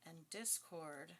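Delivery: slow, deliberate reading; slow weighty tarot reading; slow emphasis. Real, not acted. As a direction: lay each word down slowly.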